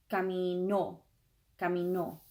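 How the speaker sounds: "caminó" with the final ó stressed; final-syllable stress. Shown as a demonstration. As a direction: punch the last syllable of "caminó". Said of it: "emphasis on the last syllable".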